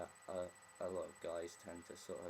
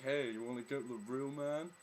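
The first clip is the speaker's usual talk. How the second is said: hammed-up loud villain swagger; lightly mock an eighties antagonist, pitched up and loud; eighties movie bad guy; 4 semitones higher, 7 dB louder than usual. Mocking the guy as a film antagonist, hammed-up bad guy. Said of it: "like, a bad guy from an eighties movie".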